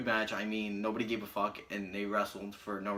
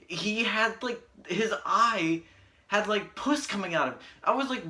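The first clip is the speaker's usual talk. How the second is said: disgusted excitement; 7 semitones higher, 4 dB louder than usual. Real, not acted. Grossed-out rant about pus eye.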